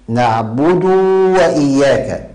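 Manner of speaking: slow demo, نعبد ending in a long "duuu"; "naʕbuduuu wa-iyyaaka", dragging the end of نعبد; overlengthened damma. Shown as a demonstration. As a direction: stretch the u of د in نعبد into a waw. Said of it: "زيادة الضمة".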